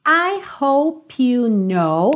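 very slow, "hope you" joined as "ho-pyu"; linked words. Shown as a demonstration. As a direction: stretch it out, carrying the p of "hope" into "you". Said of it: "é uma ligação natural".

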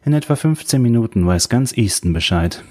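usual speaking voice

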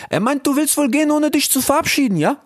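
high, cheeky youth voice; Ilias. Acted. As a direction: call out playfully, very high. Brothers voiced. (frecher Ilias, hoch und laut gerufen)

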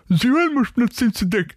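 very high, toothless mumbled punchline; toothless beer. Acted. Joker voicing the beer with knocked-out teeth, high toothless voice. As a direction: squeak it out as if the teeth are gone. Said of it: "Und so haben Zähne ausgeschlagen".

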